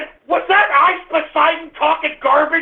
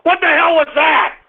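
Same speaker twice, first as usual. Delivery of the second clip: ordinary talk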